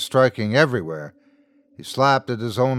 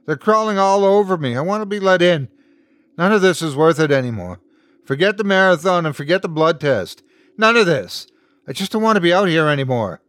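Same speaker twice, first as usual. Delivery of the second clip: high, loud desperate protest; calling out; 7 semitones higher, 5 dB louder, faster than usual. A narrator's reading. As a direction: cry out in rising distress, giving up. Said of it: "Geordie called out".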